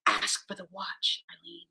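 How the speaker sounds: hushed whisper of an inner urge. Retold or imitated. Recalling the inner voice that pushed her at the race, whispered. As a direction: breathe it like a quiet nudge from inside. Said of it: "an impulse that said to me in that moment".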